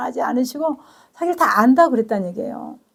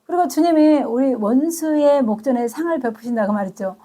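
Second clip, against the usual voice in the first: ordinary talk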